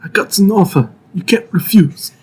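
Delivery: put-on Godfather-style mobster accent; Italian American mobster voice. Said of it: "the blend of Italian American and Christopher Walken".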